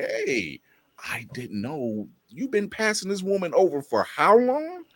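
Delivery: high-pitched, escalating disbelief; mock astonishment. Grown-up fan learning she was passed over, incredulous.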